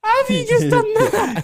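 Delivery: high, loud, laughing question; drawn-out words through real laughter; incredulous laughter. Real, not acted. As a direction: ask it while cracking up. Laughing at chair mishap.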